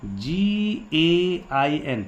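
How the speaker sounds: letter by letter, drawn out; slow spelling out. Real, not acted. Teacher spelling gain for pupils as he writes, slowly.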